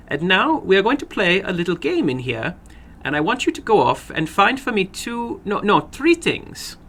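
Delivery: little man ordering the maid about, a lightly voiced eerie glee; little man giving orders. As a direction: list the tools, savouring the chopping knife.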